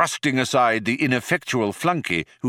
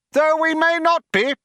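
loud, high-pitched caveat; warning interjection; 5 dB louder than usual. A narrator's reading. Younger philosopher warns the programmers, strident interjection. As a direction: butt in insistently. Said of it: "waving a warning finger at the programmers".

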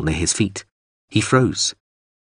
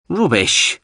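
a loud, high rubbish; 8 semitones higher, 3 dB louder than usual. A narrator's reading. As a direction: call it out firmly. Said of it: "Whoopslin said loudly".

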